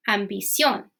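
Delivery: ambición with Spanish vowels and stress on the last syllable; Spanish pronunciation. Shown as a demonstration. Teacher gives the Spanish cognate in Spanish pronunciation. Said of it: "pronounce it like in a Spanish accent".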